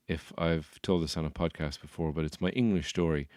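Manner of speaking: usual speaking voice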